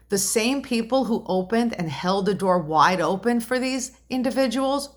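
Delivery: ordinary talk, nothing marked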